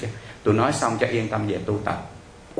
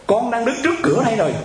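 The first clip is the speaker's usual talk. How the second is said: high, urgent reply, imitating a distraught woman; frantic caller; 12 semitones higher than usual. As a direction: act out a panicked report by phone. Caller frantically answers where she is. (giả giọng cuống quýt trả lời)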